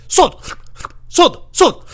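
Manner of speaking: loud, high one-word bursts; odd store customer. Retold or imitated. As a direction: blurt it high like a baffling customer. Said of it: "he starts licking his hand".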